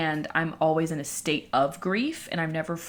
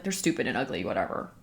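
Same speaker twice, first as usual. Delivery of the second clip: dismissive; 6 semitones lower, 4 dB quieter, faster than usual. Retold or imitated. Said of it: "he was like".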